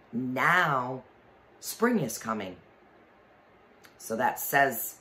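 plain reading voice

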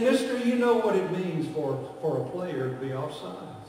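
ordinary talk

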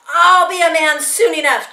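high, loud retort; defiant boy. A narrator's reading. Boy struggling in the men's arms, loud defiant retort. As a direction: push back loudly at the mockers. Boy defies the jeering Nazis.

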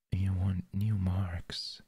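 breathy close-mic whisper. Acted. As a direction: whisper it right into the ear. Whispered roleplay.

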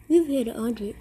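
usual speaking voice

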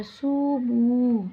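সুমু held very slow in a recital voice; elongated recitation. Shown as a demonstration. Teacher demonstrating the stretched vowel of সুমু. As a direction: draw out the long vowel of সুমু. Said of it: "এভাবে আমরা টেনে পড়বো".

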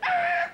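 very high, loud shriek; hammed-up shrieking cry; band's scream. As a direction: scream it right at him. Acts band screaming at the guy.

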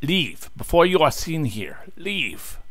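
hammed-up bad Italian accent, pitched high; mock the game guard in a bad Italian accent. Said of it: "I have bad Italian accent".

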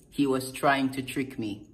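ordinary talk

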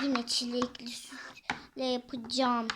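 grumbled in a whisper; a sulky whispered complaint. Acted. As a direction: mutter it under your breath, sulking. Grumbling over strawberry milk.

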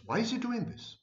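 high-pitched mock bewilderment, mimicking the confused fan; puzzled viewer. Confused narcissist viewer wondering about the speaker, mock puzzled high voice. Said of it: "this creates in you a cognitive dissonance".

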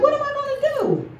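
high panicked cry of what now; panicked high-pitched retelling; replaying the moment she freaked out, panicky and high. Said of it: "I freaked out".

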